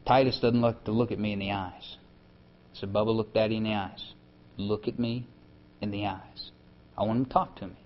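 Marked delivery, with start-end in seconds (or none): 2.7-6.5 gentle coaxing. Retold or imitated. Father coaxing his son Titus.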